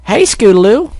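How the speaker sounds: high loud filly call. Acted. Fillies calling to Scootaloo, high loud call. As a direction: shout across the yard.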